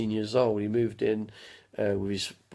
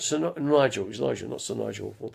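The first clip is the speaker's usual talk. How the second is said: ordinary delivery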